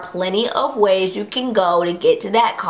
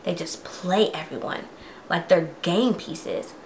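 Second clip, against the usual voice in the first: dramatic diary reading. Acted. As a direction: read it like a creepy villain's confession. Reads Andrew's diary aloud.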